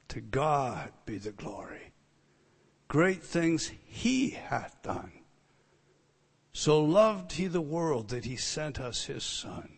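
soft, halting recital of hymn lines; hushed, spoken praise; hymn recitation. Real, not acted. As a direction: recite the hymn reverently, with pauses.

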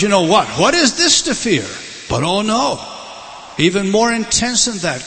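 loud, high, driving pulpit voice; impassioned sermon climax; full-force preaching. Acted. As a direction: preach it out to the whole hall. Preaching the Passion scene.

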